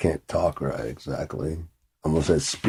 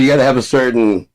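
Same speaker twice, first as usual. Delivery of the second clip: ordinary talk, nothing marked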